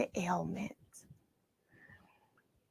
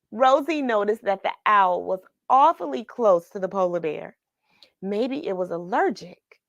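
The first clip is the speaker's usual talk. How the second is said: ordinary delivery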